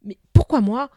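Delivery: loud, uneasy question; anxious, rising question; frankly worried. A narrator's reading. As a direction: demand an answer, worried. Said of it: "Il demanda maintenant franchement inquiet".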